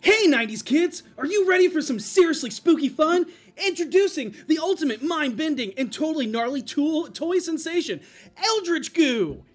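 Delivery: put-on loud, high, hyped kids commercial announcer; nineties toy ad voice. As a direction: sell it like a radical nineties toy ad.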